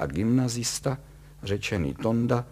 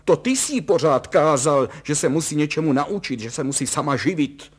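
high, loud counter-accusation; shrieky indignant wife in full flood; boiling over; 6 semitones higher, 7 dB louder than usual. A narrator's reading. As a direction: throw the blame back indignantly. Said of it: "vzkypěla paní Kalousová".

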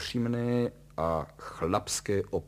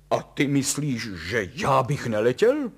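loud, raised challenge; indignant shout; 4 semitones higher, 9 dB louder than usual. A narrator's reading. Father answering his son's taunt, shouting. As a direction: fire the question back loudly. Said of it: "křikl otec Kalous".

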